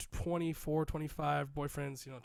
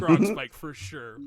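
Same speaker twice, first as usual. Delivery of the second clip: gronk spike; 12 dB louder, slower than usual. Real, not acted. Joking about the smashed freshener.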